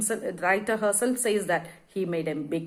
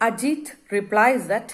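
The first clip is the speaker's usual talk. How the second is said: usual speaking voice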